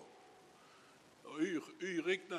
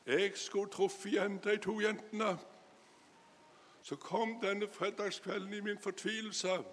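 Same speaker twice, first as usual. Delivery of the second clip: higher, louder, emphatic testimony voice; fervent retold wish; 3 semitones higher, 5 dB louder than usual. Preacher retells a saved man's wish to thank two girls, fervently. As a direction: lift the voice and press each phrase.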